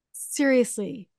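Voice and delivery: usual speaking voice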